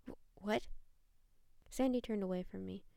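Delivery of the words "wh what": choked stammer. A narrator's reading. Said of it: "I choked on my words".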